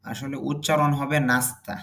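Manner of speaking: ordinary talk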